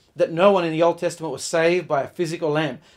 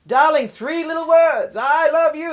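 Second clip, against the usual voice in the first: higher, louder, drawn-out pleading call; mock yell; 11 semitones higher, 7 dB louder than usual. Drunk husband yelling up to his wife, slow higher mock yell. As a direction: bellow the sweet nothings at the house. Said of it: "And he yells out".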